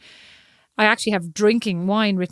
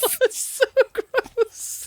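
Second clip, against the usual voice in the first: much higher, slowed by laughter; laughing disgust; 13 semitones higher, much slower than usual. Real, not acted. Co-host reacting to rude joke, laughing disgust. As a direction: squeal it out through laughing.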